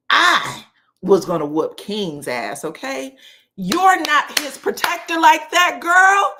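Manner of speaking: loud, high, drawn-out scolding; heated telling-off. Retold or imitated. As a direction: scold loudly, rising in pitch. Heated imagined telling-off of Greg.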